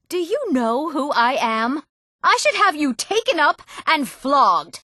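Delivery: high-pitched, haughty outrage; outraged threat. Acted. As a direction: threaten punishment like an affronted noble, pitch way up. Acted outrage, threatening a flogging.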